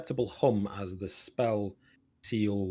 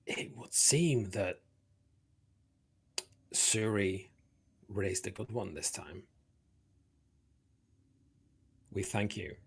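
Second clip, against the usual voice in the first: solemn dryads; 4 dB quieter than usual. Acted.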